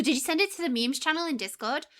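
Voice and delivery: ordinary talk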